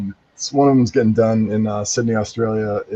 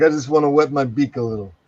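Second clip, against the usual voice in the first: Godfather impression; 2 semitones higher than usual. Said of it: "it's like the Godfather".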